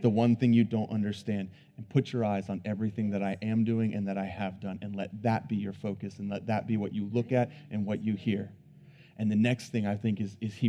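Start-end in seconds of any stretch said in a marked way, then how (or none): 0.0-8.5 gentle voice of God. Pretend. God's words voiced tenderly.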